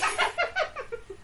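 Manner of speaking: high, breathy burst of laughter. Real, not acted. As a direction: giggle at your own confession. Laughing at Edward Cullen.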